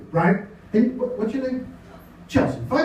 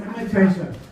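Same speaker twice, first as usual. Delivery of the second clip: ordinary talk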